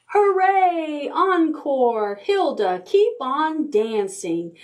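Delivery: high excited cheer, then slowing down; cheering crowd. Acted. Friends at the shore cheering Hilda, high and excited. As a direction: cheer her on like a happy crowd. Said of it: "Her friends cried out".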